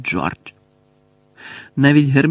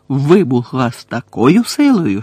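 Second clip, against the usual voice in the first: plain reading voice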